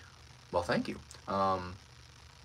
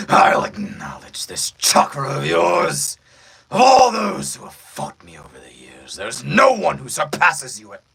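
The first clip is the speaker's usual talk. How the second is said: deep villain voice; 4 semitones higher, 10 dB louder than usual. Shown as a demonstration. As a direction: proclaim it as a battle-hungry villain. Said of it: "you guys wanna hear it deeper".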